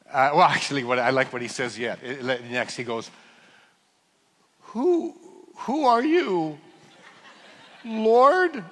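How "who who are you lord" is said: high, slow and awed. Retold or imitated. Saul asking the voice.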